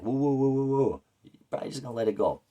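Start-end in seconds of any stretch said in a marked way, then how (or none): 0.0-1.0 high, loud mock woo-woo of a dog; high loud woo woo like a friendly dog; dog howl imitation. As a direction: imitate a playful dog howling. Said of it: "She could be like".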